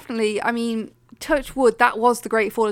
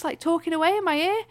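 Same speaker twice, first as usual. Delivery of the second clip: ordinary delivery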